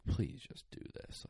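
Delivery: breathy whispered "Please just do this"; hushed begging whisper, almost no voice. Retold or imitated. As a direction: whisper the plea softly to the dogs. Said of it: "and I'm like".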